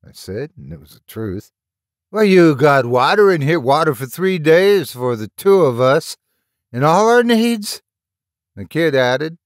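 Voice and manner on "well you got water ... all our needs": louder, higher, as realization hits; dawning alarm. A narrator's reading. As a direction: blurt it out as the worry sinks in. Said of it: "blanching with the realization".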